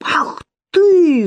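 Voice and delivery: high outraged cry; yelling. A narrator's reading. Lidia at Sofya, furious cry. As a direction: burst out in fury. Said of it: "закричала Лидия".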